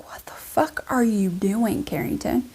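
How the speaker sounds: hushed, baffled whisper; imitating husband's whisper. Woken husband questioning his wife's packing, baffled whisper.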